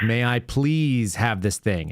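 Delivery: slow, word-by-word recital; mechanical child request. Retold or imitated. Parent imitating a kid reciting the polite steps, slow and mechanical. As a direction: spell it out like a drilled child. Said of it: "they did it very mechanically".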